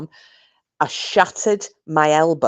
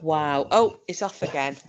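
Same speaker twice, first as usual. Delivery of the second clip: normal talking voice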